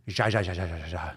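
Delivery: rapid string of "ja" syllables as laughter; Spanish text laugh. Shown as a demonstration. Host showing how Spanish texters laugh, mock laughter. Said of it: "Laughs in Spanish".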